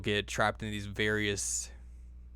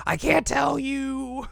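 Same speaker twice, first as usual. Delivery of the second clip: much higher and slow, mock-coy whine; coy refusal; much slower than usual. Kagome refusing to tell Inuyasha, high slow coy whine. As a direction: drag it out like a shy kid hiding a secret. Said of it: "He's like".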